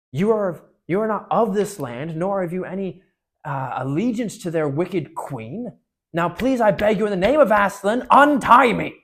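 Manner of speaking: loud, high begging that builds to a shout; desperate plea. Acted. Bound prince begging the children in Aslan's name, climbing to a shout. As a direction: beg them in Aslan's name.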